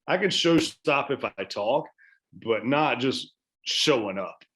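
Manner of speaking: normal talking voice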